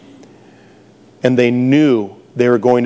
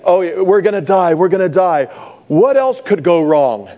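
mock panic; 7 semitones higher than usual. Voicing panicking disciples in the boat.

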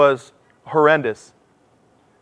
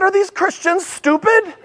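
sarcastic disbelief; slower than usual. Retold or imitated. His sarcastic inner reaction.

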